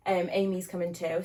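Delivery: ordinary talk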